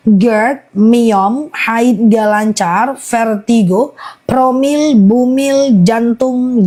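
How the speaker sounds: slow, careful listing of ailments; slow repeat. Shown as a demonstration. Host repeating the list slowly for live viewers, slow and deliberate. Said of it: "aku ulang pelan-pelan ya guys".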